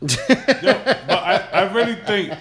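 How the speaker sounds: amused laughing protest. Real, not acted. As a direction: laugh it off before the next point. Laughing at friend's teasing.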